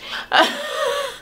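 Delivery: squealing laugh up high. Real, not acted. Laughing at the twins' insult, a high squeal.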